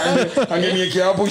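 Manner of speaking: high, giggling through it; laughing. Real, not acted. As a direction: laugh out the words. Laughing at the memory.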